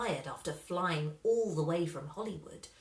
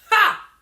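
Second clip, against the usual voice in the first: loud, much higher scoffing ha; scornful ha; 13 semitones higher, 17 dB louder than usual. A narrator's reading. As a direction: burst out with a triumphant laugh. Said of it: "He said".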